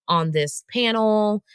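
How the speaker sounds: usual speaking voice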